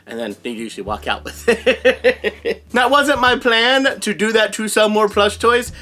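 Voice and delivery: usual speaking voice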